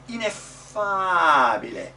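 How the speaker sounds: loud, slow "Ineffabile", "fa" held long; accent on the ah. Shown as a demonstration. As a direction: swell into the ah of "Ineffabile". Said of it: "accent is on the ah".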